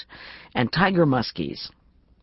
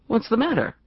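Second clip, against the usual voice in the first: suddenly sharp, higher; sharp demand; 7 semitones higher than usual. Acted. Friend hears bad news and demands sharply. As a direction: snap alert with concern. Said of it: "Marla's suddenly sharp voice demanded".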